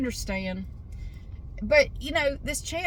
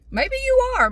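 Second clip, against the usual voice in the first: much higher and louder teasing squeal; mock squeak; 9 dB louder than usual. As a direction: tease them in a sudden high squeak. Teasing the viewer about substitute swears.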